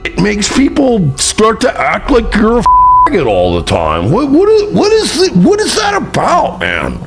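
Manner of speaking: high mocking voice. Real, not acted. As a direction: play dumb in a squeaky sneer.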